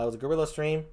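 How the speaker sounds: ordinary talk, nothing marked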